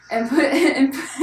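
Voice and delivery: reading aloud through a loud laugh; laughing while reading. Real, not acted. Reading a one-star review to her cohost, laughing.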